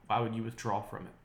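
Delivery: ordinary talk, nothing marked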